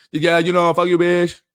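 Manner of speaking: loud, high mock accented cursing; mock broken-English accent. Mimicking the other driver's curse in broken English, mock accented yell. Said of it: "He could barely speak English good".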